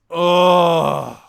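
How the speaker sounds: loud grunting groan. Retold or imitated. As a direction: let out a big groan. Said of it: "he just goes".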